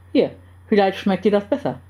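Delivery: ordinary delivery, nothing marked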